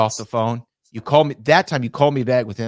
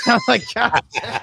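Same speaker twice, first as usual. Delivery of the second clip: much higher, laughing through it; laughing god damn at being worked; laughing disbelief; 12 semitones higher, 4 dB louder than usual. Retold or imitated. Laughing at the kayfabe news.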